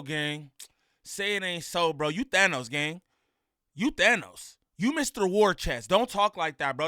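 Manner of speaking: ordinary talk, nothing marked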